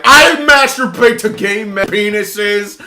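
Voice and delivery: high, slow, laughing provocation; obnoxious mock shout. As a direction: blurt it loud to shock. Imagined obnoxious outburst.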